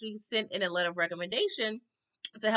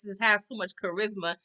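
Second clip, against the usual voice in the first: usual speaking voice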